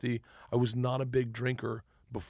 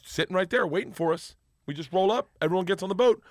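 normal talking voice

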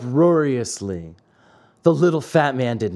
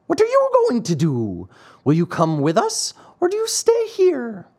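lightly voiced little man; 8 semitones higher than usual. A narrator's reading. Little man asks Pinocchio.

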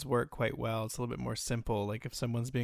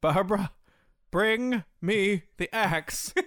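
loud, high, slow grand order; hammed-up, drawn-out film-star demand; melodramatic diva command; 8 semitones higher, 6 dB louder, slower than usual. Quoting what was said to someone, slow melodramatic command. Retold Joan Crawford movie line.

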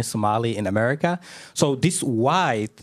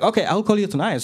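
higher-pitched, fully voiced quote; imitating the caller, pitched up; voiced phone reply; 9 semitones higher than usual. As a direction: answer aloud in a raised pitch. Caller replies aloud to whisperer.

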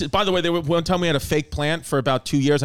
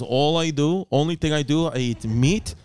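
lower-pitched imitation of a Russian mover with a thick accent; lightly put-on Russian accent, voiced lower than his own; mock Russian mover; 3 semitones lower than usual. Mover explaining his diet.

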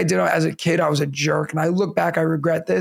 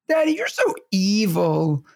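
slow, lightly imitated little kid; a hint of a child's playful accusation; kindergarten son; 3 semitones higher than usual. Son teasing Dad during a tickle chase, lightly imitated kid. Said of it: "my son's new thing right now".

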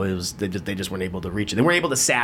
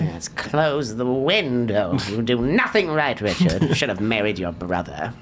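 lightly voiced old woman, slow; nagging old woman. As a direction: scold like a grumpy old wife. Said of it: "Sound like an old woman with Parkinson's".

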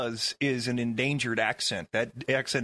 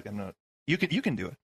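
normal talking voice

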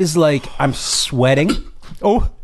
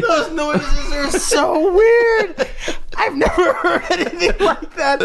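laughing; 7 semitones higher than usual. Real, not acted. Laughing at hiccup noises.

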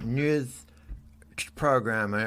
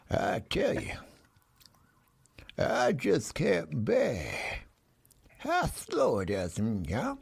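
drawling cowboy; 4 dB quieter, slower than usual. Pretend. Host drawls his clue to guests in a slow cowboy voice. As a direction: stretch every word like a lazy cowboy. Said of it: "listen to this again".

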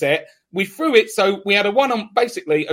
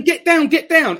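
higher, louder mock yell at a teammate; mimicking Danny Rose telling his teammate to stay down; imitated shout; 8 semitones higher, 3 dB louder than usual. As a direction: bark it like a player urging his mate down. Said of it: "he's going".

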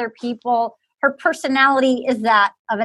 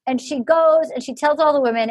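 usual speaking voice

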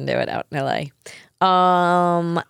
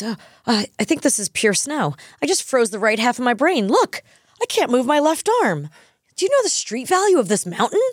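climbing much higher, giddy rant; excited snow snorter; 7 semitones higher than usual. Retold or imitated. Booger snorting snow on the mountain, rising giddy excitement. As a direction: get more and more worked up. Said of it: "he goes".

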